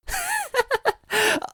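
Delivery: very high villain cackle; evil high cackle. Acted. Villain cackling at the threat. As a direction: cackle with glee.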